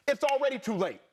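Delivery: ordinary talk, nothing marked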